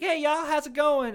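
high, loud, slow Southern greeting; friendly Southern lady. Retold or imitated. Lady rolling up on strangers, imitated friendly Southern greeting. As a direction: mimic a chirpy Southern hello. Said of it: "like, friendly Southern lady".

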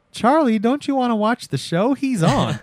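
high-pitched mock girlfriend voice; put-on high, pleading girl voice; coaxing girlfriend. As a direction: coax in a high imitation of the girlfriend. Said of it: "your girl is just like".

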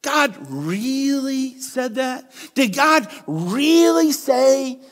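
slow, drawn-out sarcastic serpent; mock God's word with slow scorn; mocking disbelief. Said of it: "he's mocking God".

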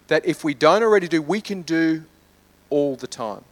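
usual speaking voice

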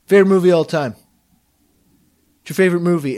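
hint of a broey loudmouth quizzing people; mock bro, punched out loudly at first; obnoxious bro. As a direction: imitate a pushy, loud drunk bro. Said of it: "so intensely obnoxious".

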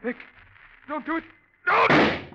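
high panicked pleading scream; terrified scream. Acted. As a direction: scream it in terror. Pleading before being shot.

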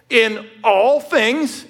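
slow, high, every word drawn out, "all" hit hardest; stress on all. Shown as a demonstration. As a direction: stretch out "in all things" and punch "all". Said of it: "emphasis on the all".

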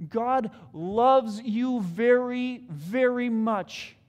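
slow, tender, drawn-out very much; slow down and let it land; tender emphasis. Real, not acted. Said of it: "tender slowed declaration".

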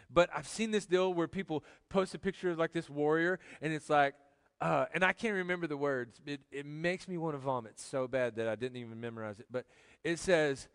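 ordinary talk

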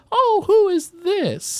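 put-on high, slow elderly lady; a curious old lady peering at a newcomer; old woman voice. Old woman noticing the dragonborn, high slow voice. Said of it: "and she says".

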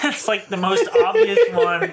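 cracking up at the punchline; laughing. Real, not acted. Laughing at own story.

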